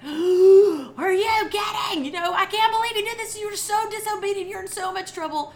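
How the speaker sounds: high, loud scolding yell; shocked mother yelling at her child; angry mom outburst. Retold or imitated. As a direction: scold the child at full volume. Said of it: "my knee-jerk reaction is like".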